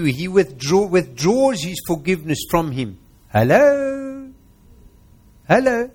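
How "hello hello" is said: much higher, louder called hello; sharp rising hello to the congregation; incredulous hello. Real, not acted. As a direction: wake them up with a shocked hello. Calling out for attention, incredulous.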